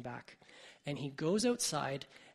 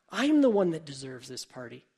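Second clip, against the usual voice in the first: louder, indignant claim; jealous older brother demands the party, louder; indignant outburst; 9 dB louder than usual. Retold or imitated. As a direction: insist on it, voice rising in anger. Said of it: "he got real angry".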